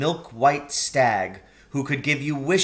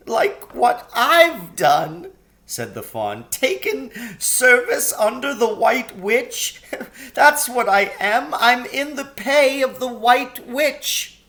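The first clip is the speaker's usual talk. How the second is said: tearful confession; 11 semitones higher, 6 dB louder than usual. A narrator's reading.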